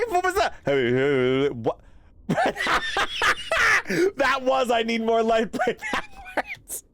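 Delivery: startled laughing outburst; laughing shock. Real, not acted. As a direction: blurt it out mid-laugh. Laughing at creepy voice.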